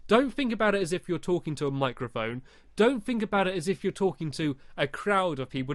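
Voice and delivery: ordinary delivery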